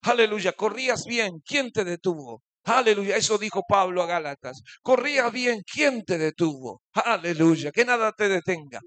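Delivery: loud, rising pulpit calls to the congregation; full-force preaching. Acted. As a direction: preach it out, urging them on. (predicación a todo pulmón)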